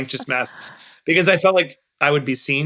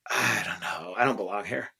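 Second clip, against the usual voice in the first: quiet confession; 5 semitones lower, 9 dB quieter than usual. Retold or imitated. Retold confession of self-doubt.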